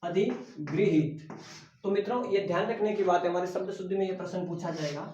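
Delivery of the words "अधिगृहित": drawn out answer. Shown as a demonstration. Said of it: "तो क्या हो जाएगा इसकापको".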